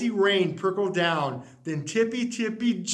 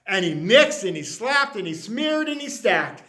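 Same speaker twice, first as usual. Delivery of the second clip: usual speaking voice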